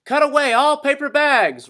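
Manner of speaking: very high, loud command; roar. A narrator's reading. Owner roaring orders from shore, very high loud command. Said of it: "roared the owner".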